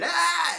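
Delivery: very high, very loud imitated yelp; mock yelp of pain. Imitating Miguel hit by a dart, a very high and loud shriek. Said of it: "I hear Miguel going".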